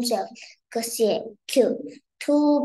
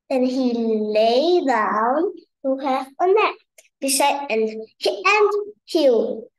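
plain reading voice

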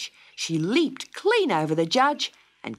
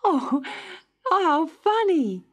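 much higher, unafraid giggly voice; amused little girl; 5 dB louder than usual. A narrator's reading. Dot laughs off the trial, high and amused. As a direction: Dot finds the trial a game. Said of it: "said Dot".

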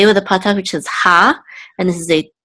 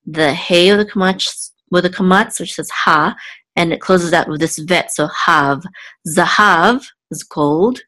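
ordinary delivery

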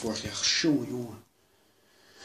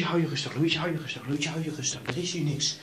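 ordinary talk

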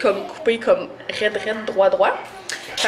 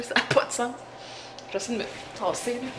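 whispering; 9 dB quieter, faster than usual. Real, not acted. Quiet aside about her hair.

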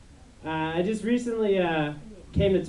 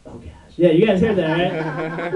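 ordinary delivery

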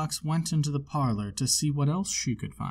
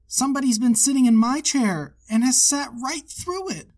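put-on much higher, tiny baby bear squeak; little wee bear voice; 10 semitones higher, 5 dB louder than usual. A narrator's reading. Baby bear finds his porridge all gone, high tiny baby bear voice. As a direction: squeak it like the smallest cub. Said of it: "in his little wee voice".

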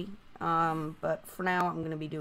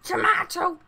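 silly shout; 12 semitones higher, 9 dB louder than usual. Real, not acted.

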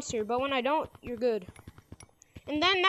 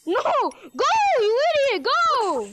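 fast, high frantic yell; shouting; 7 semitones higher, 5 dB louder, slower than usual. Real, not acted. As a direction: scream at the player to move. Player about to lose the race.

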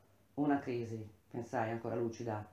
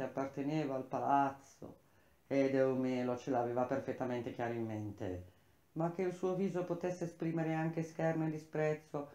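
plain reading voice

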